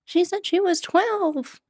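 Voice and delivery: high, slow mock quote; mimicking the video. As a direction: repeat the meme line softly. Joke quote about the dog.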